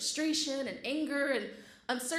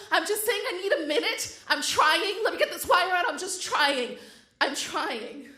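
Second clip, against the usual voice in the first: frantic outburst; 7 semitones higher, 7 dB louder than usual. Retold or imitated. Pleading at the hot guard with a bra in her teeth, frantic and loud. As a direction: let eight years burst out at once.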